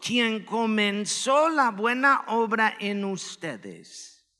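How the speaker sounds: ordinary delivery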